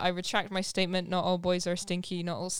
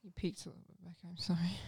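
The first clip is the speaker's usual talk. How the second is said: very soft, hushed apology; whispered aside; 2 semitones lower, 13 dB quieter than usual. Real, not acted. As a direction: whisper it off to the side. Whispered technical aside on recording.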